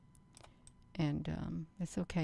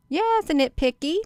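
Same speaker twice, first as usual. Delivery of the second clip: high, loud burst on nitpicky; a sudden high loud cry of nitpicky; excited outburst; 11 semitones higher, 11 dB louder than usual. Real, not acted. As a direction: blurt it out in a sudden high yelp.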